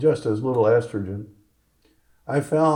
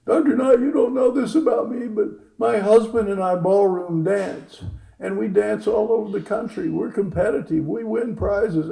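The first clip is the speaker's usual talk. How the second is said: high, put-on patient's voice, slowing at points; acted patient telling her story, high; imitated gushing patient; 8 semitones higher, 3 dB louder than usual. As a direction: pitch it way up as the earnest patient.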